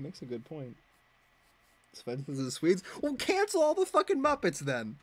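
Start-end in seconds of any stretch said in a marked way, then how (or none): 3.0-5.0 much higher, exasperated; mock-indignant cancel rant; mock outrage. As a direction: throw up your hands in fake indignation.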